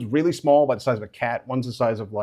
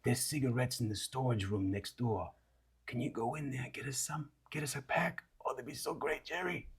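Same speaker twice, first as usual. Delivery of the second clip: much softer, coaxing creature plea; wheedling Moof; 16 dB quieter than usual. Acted. Moof coaxing Jerry to fetch cigarettes, much softer. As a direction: sweet-talk him quietly into it.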